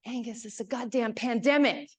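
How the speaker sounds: a hammed-up fed-up governor snapping back. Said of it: "I'll do my best [name] impression".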